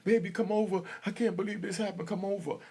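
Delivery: high, pleading mom voice; imitating scared mom. Mother on the phone begging her son to come over, high and pleading.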